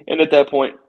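words cut by a hiccup; talking through the hiccups. Real, not acted. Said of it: "excuse me, I got the hiccups".

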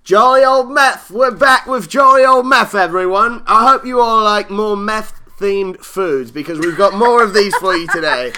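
mock announcer voice. Host announces the segment to listeners, hammed-up showman voice. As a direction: sell it like a hammed-up game show host. Hosts open segment in a put-on voice.

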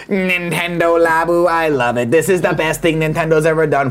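slow, gushing fanboy imitation; lightly voiced excited fan; mock fanboy voice. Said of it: "All you motherfuckers are gonna be like this".

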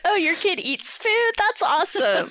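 high mock-sweet delight; sarcastic. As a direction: fake enthusiasm, dripping sarcasm. Mocking other parents' complaints.

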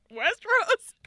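high, loud questioning squeal; incredulous. Retold or imitated. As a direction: blurt the name in baffled disbelief. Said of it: "but I was like".

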